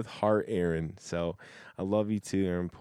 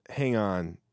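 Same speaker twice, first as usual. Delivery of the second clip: usual speaking voice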